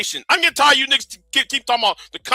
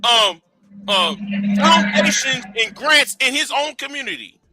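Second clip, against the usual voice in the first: slow, halting; slower than usual. Real, not acted. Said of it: "how it take you that long to say that".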